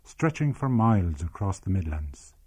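measured storytelling read; story narration. A narrator's reading. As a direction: tell the village tale evenly, like a documentary. Documentary narration of memoir.

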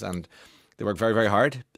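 ordinary delivery

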